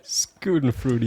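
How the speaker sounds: Schadenfreude mangled into "Scootin' Fruity", slowly; "Scootin' Fruity" drawn out like English nonsense; mock mispronunciation. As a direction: imitate a wrong-pronunciation tutorial on "Scootin' Fruity". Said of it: "falsche Aussprache von Wörtern".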